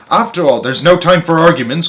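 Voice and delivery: lightly voiced excited Mr Wonka; crying out. A narrator's reading. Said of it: "cried Mister Wonka".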